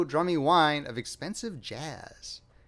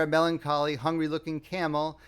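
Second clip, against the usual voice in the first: plain reading voice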